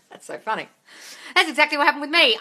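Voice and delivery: ordinary talk, nothing marked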